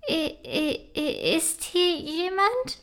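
high, soft, slow frightened stammering; unsure stammer. A narrator's reading. Princess startled by a ghost at midnight, stammering. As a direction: stammer out the question, scared of the dark. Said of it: "stammelt Prinzessin Serafina unsicher".

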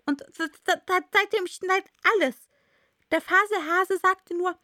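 high, nervous stutter; stotternder Hutmacher. Acted. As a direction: stumble over the words in a high voice. Nervous Hatter stammers on. (hoch, ängstlich stotternd)

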